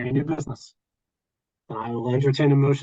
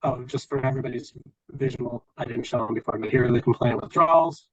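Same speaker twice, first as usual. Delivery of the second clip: ordinary talk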